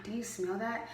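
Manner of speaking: breathy hushed whisper, no voice at all. Retold or imitated. Friend on the bus secretly whispering about the smell.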